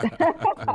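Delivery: short high laugh. Real, not acted. Friend laughing at the [name] joke. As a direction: laugh it off, amused.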